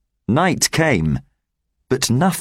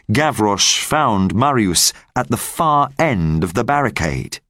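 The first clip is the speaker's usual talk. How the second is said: plain reading voice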